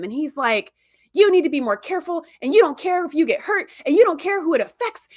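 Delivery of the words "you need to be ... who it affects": mock scolding, pitched up and emphatic; going off on him. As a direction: imitate a worked-up doctor's rant, higher throughout. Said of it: "starts going off on him".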